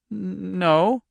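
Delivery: high, halting, scared no; frightened stammer. A narrator's reading. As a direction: stumble nervously into the word. Frightened waitress answers her captor.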